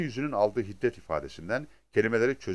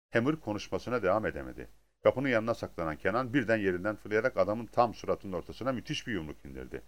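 ordinary delivery

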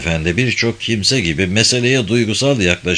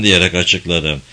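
ordinary delivery, nothing marked

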